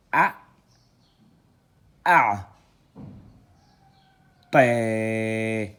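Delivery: loud, drawn-out syllables read one by one; syllable reading drill. Shown as a demonstration. Teacher spelling out syllables loudly for students. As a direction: sound out each letter for the class. Teacher sounds out Arabic letters.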